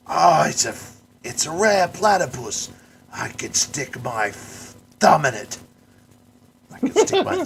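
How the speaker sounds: high, loud excited Aussie showman; Steve Irwin impression. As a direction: gush like a wildlife TV host. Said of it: "He goes".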